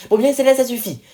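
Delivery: put-on mother scolding her girls; stern scolding. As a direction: cut the children off firmly.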